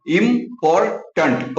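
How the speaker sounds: इंपॉर्टेंट slowed, each syllable separated; teacher breaking इंपॉर्टेंट into syllables. Shown as a demonstration. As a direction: sound out इंपॉर्टेंट carefully and slowly. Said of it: "ये जो multi syllable है".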